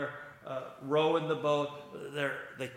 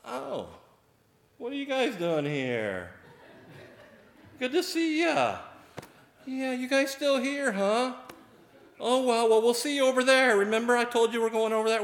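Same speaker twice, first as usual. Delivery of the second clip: much higher, fast, offhand and cheery; breezy Jesus; 12 semitones higher, 5 dB louder than usual. Retold or imitated. Preacher imagines Jesus greeting disciples, high and cheery. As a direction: breeze past them like an old pal. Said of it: "Did he get out there and".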